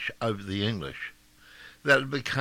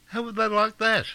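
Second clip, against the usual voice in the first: challenging; 10 semitones higher, 4 dB louder than usual. Real, not acted. Rhetorical challenge to opponents.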